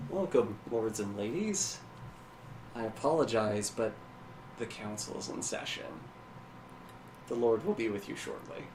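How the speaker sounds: lightly voiced, worn-out host; drained of energy. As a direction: try to be welcoming with little energy left. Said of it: "energy has just been drained away".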